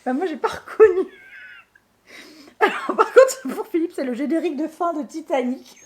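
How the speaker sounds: high, loud, laughing through words. Real, not acted. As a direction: talk while cracking up. Laughing at the gargled singing.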